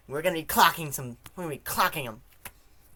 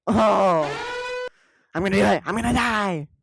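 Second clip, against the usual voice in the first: loud, high wailing despair; hammed-up despairing howl; 6 semitones higher, 3 dB louder than usual. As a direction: howl like life is ending. [name] wailing offscreen.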